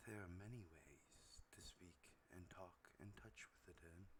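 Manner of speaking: very soft, low, breathy whisper; sensual dark whisper. Acted. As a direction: whisper it out barely audibly, like a dark spirit. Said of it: "whispers out to you and says".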